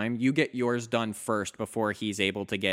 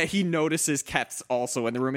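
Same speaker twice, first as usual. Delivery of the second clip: ordinary delivery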